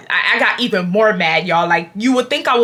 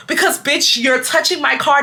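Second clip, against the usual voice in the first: ordinary delivery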